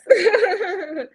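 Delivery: high laughing burst. Real, not acted. Client laughing while thanking her coach. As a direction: laugh warmly at the memory.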